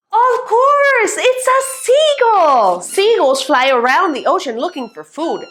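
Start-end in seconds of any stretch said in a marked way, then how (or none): 0.1-2.8 high, swooping exclamation; excited discovery. Acted. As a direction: announce it like a happy revelation.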